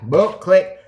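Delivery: high clicky hang-up noise; mouth click sound. Retold or imitated. Hanging up on Jimmy John, a high clicky boop. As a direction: make the phone click with your mouth.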